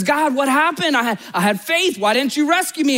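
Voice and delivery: high-pitched acted complaint; mock frustration, pleading for an explanation; frustrated. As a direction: protest like a let-down believer. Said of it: "He's frustrated".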